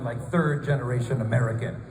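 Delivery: usual speaking voice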